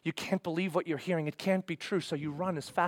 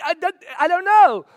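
loud, high, stammered doubt; agitated confusion; 12 semitones higher, 13 dB louder than usual. Acted. Follower hearing the cry from the cross, loudly confused. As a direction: stumble over it, at a loss.

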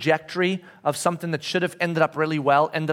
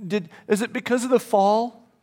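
higher, lightly voiced little boy; tearful child imitation; 4 semitones higher than usual. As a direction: imitate a child asking through tears, understated. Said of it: "he's weeping, and he, and he's crying".

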